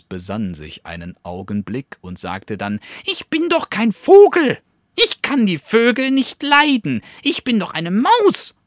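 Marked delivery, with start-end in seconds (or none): 3.0-8.6 very high, loud squeaky protest; insisting bat. A narrator's reading. Bat protesting to the weasel that it's a mouse, high squeaky insistence. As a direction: squeal that you are no bird. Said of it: "beteuerte sie".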